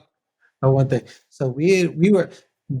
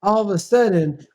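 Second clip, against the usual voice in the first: normal talking voice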